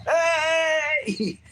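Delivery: high sharp shout of "Hey!"; yelling at him. Retold or imitated. Rider on the road shouting at the driver, loud. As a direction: bark it out at the driver beside you. Yelling at kid beside car.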